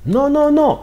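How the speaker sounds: high squeaky mock protest; mock meek reply. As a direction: squeak out the denial. Mocks Shin's meek reply.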